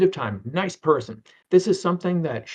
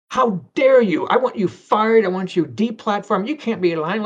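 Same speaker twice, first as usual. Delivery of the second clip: mock indignant shouting, pitched up; hammed-up outrage, loud and high; outraged critic; 5 semitones higher, 5 dB louder than usual. Mocking an offended critic who demands firing, loud indignant rant.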